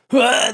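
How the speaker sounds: a sharp pained cry out of nowhere; retold scream. Soldier recalling the moment he got hit, loud yelp. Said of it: "like, loud, like".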